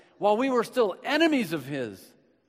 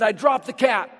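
louder and higher, punch DROPPED hard; emphatic repeat; 7 semitones higher, 8 dB louder than usual. Retold or imitated. Pastor repeats David's boast to the church, loud and emphatic. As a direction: hit "dropped" like a triumphant boast.

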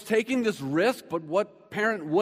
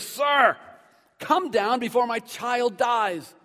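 loud, high outcry, then drawn out; acted forceful begging; desperate plea; 5 semitones higher, 6 dB louder, much slower than usual. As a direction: cry out urgently for a dying child. Acts out official's desperate plea.

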